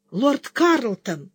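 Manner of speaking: breathless, slow call out. A narrator's reading. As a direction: call his name, out of breath. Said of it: "задыхаясь, крикнула она".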